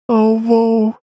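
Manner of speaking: put-on high-pitched dejected boy; downbeat child. A narrator's reading. Boy suddenly dreads his fate, downbeat child voice. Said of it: "All at once it seemed rather downbeat".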